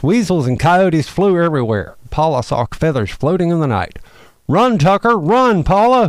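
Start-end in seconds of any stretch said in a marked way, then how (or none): 4.5-6.1 high, urgent storybook shout; shouted warning. A narrator's reading. Owl shouts to the two fleeing friends, urgent shout. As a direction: call out a higher-pitched warning to flee. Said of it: "Hooty shouted".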